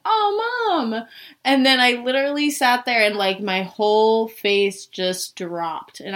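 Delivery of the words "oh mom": touched. Retold or imitated. Thinks mom sent flowers.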